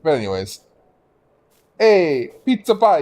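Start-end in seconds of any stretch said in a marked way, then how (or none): 1.8-3.0 loud high hammy Italian "pizza pie". Retold or imitated. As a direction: belt it like a cartoon Italian. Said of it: "You want me to be racist?".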